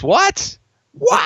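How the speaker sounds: rising high mock shock; mock disbelief. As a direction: squeak out fake disbelief.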